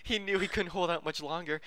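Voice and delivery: ordinary talk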